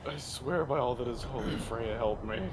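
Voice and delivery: low, soft plea to a goddess; exasperated prayer. Acted. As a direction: pray quietly for patience.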